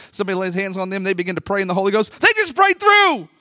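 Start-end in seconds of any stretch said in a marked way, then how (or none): 2.2-3.3 louder, higher and drawn out; exclaimed payoff. Real, not acted. Preacher caps the list for the congregation, exclaiming. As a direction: shout it out, stretching the words.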